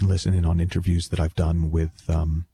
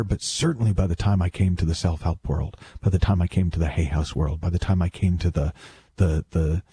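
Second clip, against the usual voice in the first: usual speaking voice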